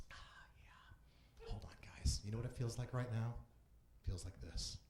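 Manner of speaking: whispered aside to the crowd. Acted. Comic whispering to the audience, hushed. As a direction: drop to a hushed whisper, conspiratorial.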